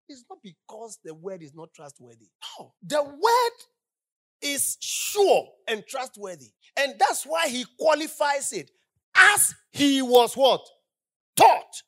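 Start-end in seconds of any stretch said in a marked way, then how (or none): 2.8-6.5 loud, slow sermon emphasis, hammering each word; preaching at full force. Real, not acted. 6.8-11.8 preaching at full force. Real, not acted.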